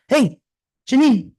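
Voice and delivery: high, loud call up the stairs; mock father calling upstairs; dad calling up.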